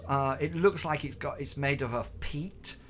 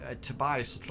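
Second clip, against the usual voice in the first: ordinary talk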